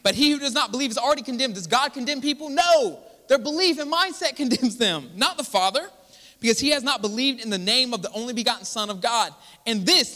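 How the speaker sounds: high, emphatic pulpit argument; impassioned preaching. Real, not acted. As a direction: press the point fervently on the congregation. Preaches at full force.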